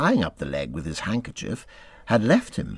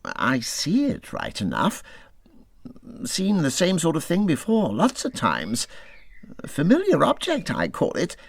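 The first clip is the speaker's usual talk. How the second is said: slow, unimpressed mole; 7 semitones higher than usual. A narrator's reading. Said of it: "he said at last slowly".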